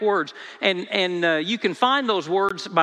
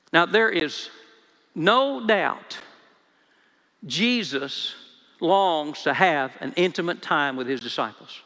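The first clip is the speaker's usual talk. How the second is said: normal talking voice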